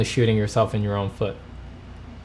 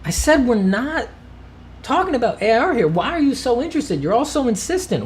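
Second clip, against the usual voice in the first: much higher, squeaky exasperated voice; squeaky indignant rant; 10 semitones higher than usual. Acted. Streamer ranting at chat about AI art, squeaky and exasperated. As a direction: squeal it out like a fed-up cartoon.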